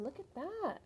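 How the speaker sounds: ordinary delivery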